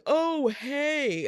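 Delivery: cheery petitioner greeting. Retold or imitated. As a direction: chirp a fake-friendly hello.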